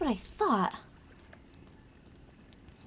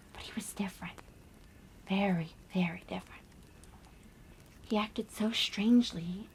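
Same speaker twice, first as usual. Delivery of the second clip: hushed, mostly whispered ghost story; spooky campfire story; 6 dB quieter than usual. Acted. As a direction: draw the listeners in with a secretive hush.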